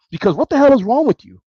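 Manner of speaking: high, loud outraged question; furious rant at fans over hiring Meyer; exasperated. Real, not acted. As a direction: blow up at them. Ranting at fans.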